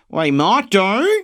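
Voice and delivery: slow, high, loud Australian; exaggerated Australian with rising end; mock Australian accent. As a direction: stretch it in broad Australian. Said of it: "Like an Australian coming in".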